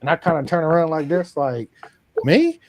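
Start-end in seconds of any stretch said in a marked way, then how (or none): 2.2-2.6 much higher and louder squeak of disbelief; as if nobody could mean you; shocked, high-pitched "Me?"; surprised. Retold or imitated. Guy asks how fishing was.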